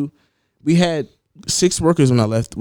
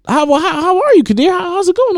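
high, chirpy fake-nice boss voice, a lot higher; fake friendly manager; 4 dB louder than usual. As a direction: mimic her sugary small talk.

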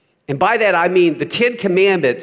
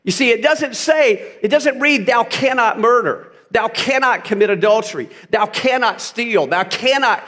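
raised, impassioned sermon voice, higher; full-force preaching; 7 semitones higher than usual. Acted. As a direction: preach it hard to the congregation.

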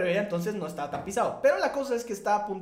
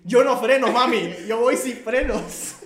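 dramatic yell; 3 semitones higher, 7 dB louder, slower than usual. Shown as a demonstration. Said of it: "como lo dijo textualmente".

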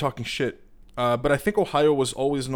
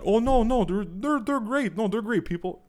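mocking defender; 8 semitones higher than usual.

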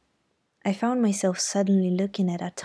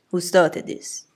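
louder, firm demand; stern uncle; 6 dB louder, slower than usual. A narrator's reading. Uncle demanding who started the fight, firm loud.

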